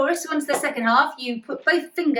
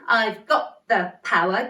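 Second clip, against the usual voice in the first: slow, got and power punched out; stress on got and power; 2 semitones lower, slower than usual. Shown as a demonstration. Teacher showing the kids, slow stress on got and power. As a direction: hammer got and power, slowly. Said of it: "The emphasis is on got and power again".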